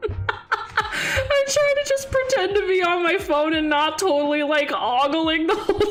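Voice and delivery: high, giggly words through real laughter; laughing while talking. Real, not acted. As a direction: tell it while cracking up. Laughing at her own ogling.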